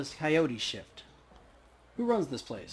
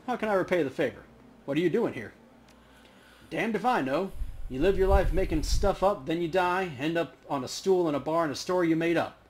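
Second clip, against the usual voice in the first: lightly voiced grizzled old ghost, louder; a hint of the weary barfly ghost, pleased to see a backer; a louder, slightly raised old-timer's voice; ghost veteran voice; 3 semitones higher, 6 dB louder than usual. NPC ghost dialogue read aloud.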